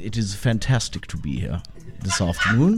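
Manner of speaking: mock-formal British accent; English accent. Shown as a demonstration. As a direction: imitate a British gentleman's accent, low and measured.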